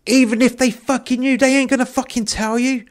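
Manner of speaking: high-pitched, agitated protest; worked-up, incredulous appeal; exasperated plea. Acted. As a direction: plead in a high, insistent voice. Dave pleads with the cop.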